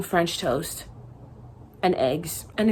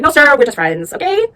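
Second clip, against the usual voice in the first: louder, rising to a high okay at the end; firm refusal; 6 semitones higher, 7 dB louder than usual. Retold or imitated. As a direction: brush him off firmly but politely. Refusing a man's advances.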